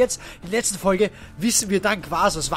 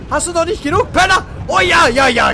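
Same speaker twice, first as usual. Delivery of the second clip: high, loud jeering; gloating taunt; 6 semitones higher, 4 dB louder than usual. Real, not acted. Mocking the beaten monster, jeering loudly.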